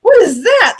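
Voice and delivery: high, loud burst of disbelief; shocked. Retold or imitated. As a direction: re-enact the shock of finding it. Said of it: "you're just shocked".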